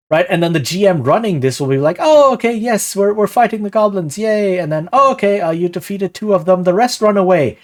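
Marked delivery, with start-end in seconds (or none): 2.0-4.6 higher and louder, hammed-up chirpy yay; sing-song mock enthusiasm, up high; mock cheery GM. GM reacting to the goblin fight. 4.9-7.6 higher and louder, hammed-up chirpy yay; sing-song mock enthusiasm, up high; mock cheery GM. GM narrating the fight result.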